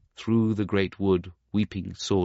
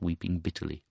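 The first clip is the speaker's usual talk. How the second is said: ordinary delivery, nothing marked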